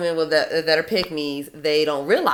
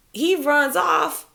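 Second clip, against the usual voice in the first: usual speaking voice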